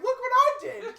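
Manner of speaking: high, loud, laughing mock boast; hammed-up triumphant squeal; proud dog. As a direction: shout it like a dog showing off its catch. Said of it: "he's like".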